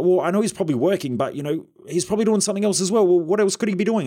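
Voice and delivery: lightly voicing a suspicious friend; mock worried friend.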